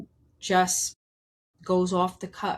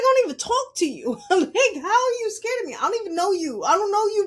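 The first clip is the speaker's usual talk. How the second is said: high, loud retold disbelief; incredulous protest; 11 semitones higher, 5 dB louder, faster than usual. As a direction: protest in a high, half-laughing voice. Acts out baffled protest, laughing.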